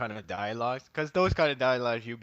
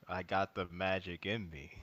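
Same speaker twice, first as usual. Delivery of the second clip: monotone delivery; 4 semitones lower, 8 dB quieter than usual. Retold or imitated. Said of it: "very monotone".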